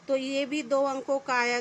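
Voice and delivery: ordinary delivery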